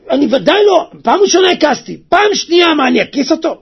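high, loud protest; loud, high outburst of refusal; acted indignant refusal. Acts the man's indignant refusal.

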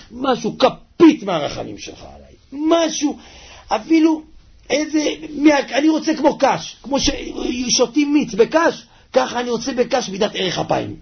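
high-pitched, insistent pleading; begging God. Acted. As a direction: beg for a tiny drop of mercy. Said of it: "אני מבקש, ריבונו של עולם".

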